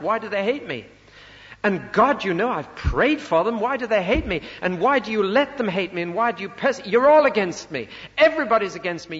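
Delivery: high, loud, pleading lament to God; anguished heart cry. Acted. Prophet crying out to God in lonely anguish, loud and high. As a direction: cry out, hurt and bewildered, at everyone turning away. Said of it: "It's a heart cry".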